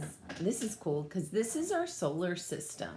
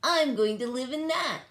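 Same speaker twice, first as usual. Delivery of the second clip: higher, louder, eager; excited claim; 7 semitones higher, 5 dB louder than usual. Acted. Bork claims the rover, higher and louder. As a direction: announce it with glee. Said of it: "she said".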